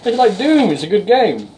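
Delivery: loud, very high-pitched teasing; high mock voice. Teasing co-host about an old game, in high mock voice. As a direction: needle them in a squeaky high voice.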